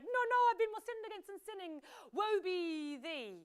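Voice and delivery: high, loud theatrical wailing, slowing at the end; King Lear. Acted. Lear to his sidekick on the heath, wailing theatrically. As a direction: cry it out as a hammed-up tragic lament. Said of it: "He was like".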